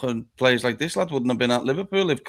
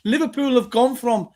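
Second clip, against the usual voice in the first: exasperated rant; 9 semitones higher, 4 dB louder than usual. Real, not acted. Exasperated rant about club.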